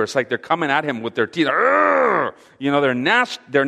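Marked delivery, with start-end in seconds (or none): 1.5-2.3 high, loud mock growl; angry snarl. Preacher imitates leaders gnashing at Stephen, angry snarl. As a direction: snarl as if coming at him with teeth. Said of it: "They're showing their anger".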